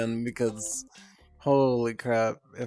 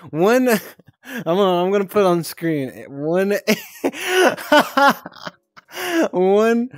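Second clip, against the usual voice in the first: loud laughter breaking up the numbers; laughing loudly; 7 semitones higher, 7 dB louder than usual. Real, not acted. Reading her joke hotline number, laughing loudly. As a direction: laugh hard through it.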